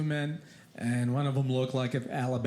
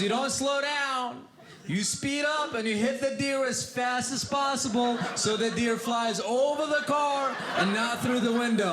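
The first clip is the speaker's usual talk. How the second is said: lightly voiced girlfriend lecturing him; confident girlfriend; 9 semitones higher than usual. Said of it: "She goes".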